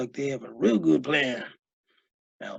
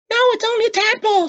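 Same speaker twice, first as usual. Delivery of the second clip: put-on high, loud boyish voice; boy calming the coach; 9 dB louder, faster than usual. A narrator's reading. Boy defending his pet, high and loud. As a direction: explain it away eagerly. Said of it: "said Lois".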